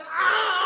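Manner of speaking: loud high yelp of panic, no words; panicked yelp. Retold or imitated. The speaker, cornered by the question, panicked yelp. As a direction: blurt out a helpless squawk.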